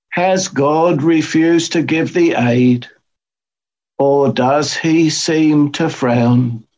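reading the closing hymn to the chapel; hymn read aloud. Acted. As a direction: read each line out with care.

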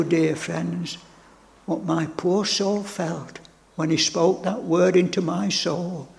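ordinary talk

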